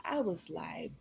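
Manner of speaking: ordinary talk, nothing marked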